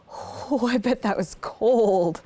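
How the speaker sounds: ordinary delivery, nothing marked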